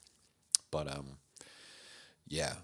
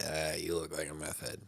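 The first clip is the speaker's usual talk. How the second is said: pharmacist voice; 4 dB quieter than usual. Retold or imitated.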